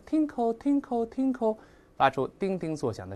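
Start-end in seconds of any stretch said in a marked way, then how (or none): 0.1-1.6 high, slow, bell-like repetitions; singsong onomatopoeia, pitched up; sound-imitating chime. Shown as a demonstration. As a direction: make tinkle ring like a chime. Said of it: "那拟声嘛".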